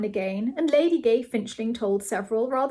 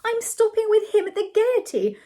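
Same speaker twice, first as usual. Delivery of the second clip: very high, irritating and louder; annoying voice; 12 semitones higher, 5 dB louder than usual. A narrator's reading. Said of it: "said Ethel in an annoying voice".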